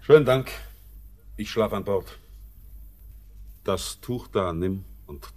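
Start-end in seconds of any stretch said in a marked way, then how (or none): 0.0-0.5 laut und höher, abweisend; schroffer Dank. Acted. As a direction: den Dank scharf hinwerfen. Curt reply to offer. (abweisend laut)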